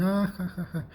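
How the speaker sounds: high, short mock laugh; imitated crowd laughter. Retelling everyone laughing next door, high mock laughter. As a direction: mimic everybody breaking into laughter. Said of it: "everybody starts laughing".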